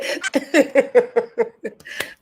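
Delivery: breathy high laugh; laughing at herself. Real, not acted. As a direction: crack up at your own voice. Laughs at her own voice.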